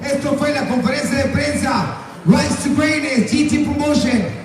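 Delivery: booming ring announcer hype; lucha libre announcer. Acted. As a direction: call it out to the crowd like a fight night. Closes event as announcer.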